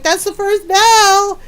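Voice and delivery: high, loud and drawn-out; mock schoolkids' holler; gleeful kids' announcement. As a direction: call it out slowly, milking every word. Kids gleefully announce the bell.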